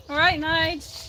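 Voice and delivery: high-pitched hello to a pet; greeting a cat. Real, not acted.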